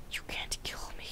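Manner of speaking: unvoiced teasing whisper. Chicken taunts the attacker, sly whisper. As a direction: hiss the taunt under your breath.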